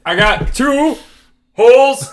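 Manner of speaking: high, loud squeal on holes; squeaky high shout. Pretend. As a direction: squeal it out, hammed up. Drunk crude joke.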